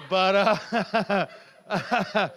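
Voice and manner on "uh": ordinary delivery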